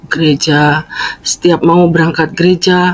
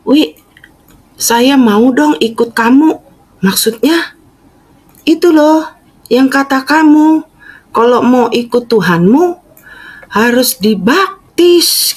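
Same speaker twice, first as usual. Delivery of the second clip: mother's earnest request, pitched up; mother asking; 8 semitones higher than usual. Retold or imitated. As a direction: voice mom pleading to be baptised. Mother asks to be baptised.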